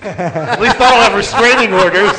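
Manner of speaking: At least I don't have restraining orders said through laughter; laughing. Real, not acted. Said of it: "repeated line".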